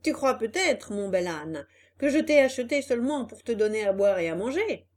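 loud, scolding boss; angry boss. Acted. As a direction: yell orders at the donkey.

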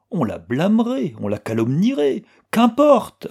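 high, rising to a loud dismissal; defiant. A narrator's reading. Anna braves gossip to see Axel, defiantly. Heroine's defiant inner thoughts, narrated.

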